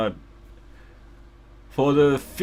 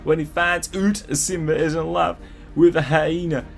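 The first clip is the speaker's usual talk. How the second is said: mock American accent, pitched higher; exaggerated American accent; 4 semitones higher than usual. Reading the author's note aloud, in a mock American accent. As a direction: read it like a gushing American teen writer, a hint of American. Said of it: "in the most American accent I can gather".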